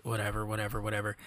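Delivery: brushing it all off, barely bothering; dismissive shrug. Retold or imitated. Numbed by covid news, mumbling an indifferent whatever. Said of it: "I'm just kinda like".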